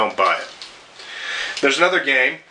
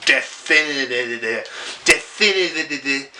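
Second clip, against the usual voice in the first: lisping on Definitive; tongue stumbling over Definitive; slower than usual. Real, not acted. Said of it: "I sound like I got a fucking Mike Tyson lisp".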